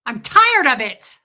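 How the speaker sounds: loud, slow, exaggerated protest; mock exasperation. Comedian replaying her protest to her partner, mock exasperated. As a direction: draw it out loudly, fed up. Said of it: "And I was like".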